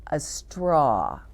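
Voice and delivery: gentle storybook reading to little ones; reading to children. Acted. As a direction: read it out slowly like a picture book. Reads picture book to children.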